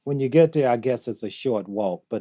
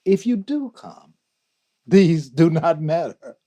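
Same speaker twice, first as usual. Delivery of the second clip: higher, louder, rising fervour; impassioned recitation; 6 semitones higher, 8 dB louder than usual. Acted. Guest recites Rumi's contrasting line, impassioned recitation. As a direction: lift the verse into a ringing declaration.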